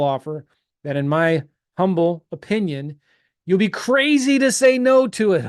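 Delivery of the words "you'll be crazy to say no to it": high, loud half-laughing sell; playful hard sell; excited pitch. Real, not acted. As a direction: push the offer with a grin in the voice. Pitching an irresistible offer.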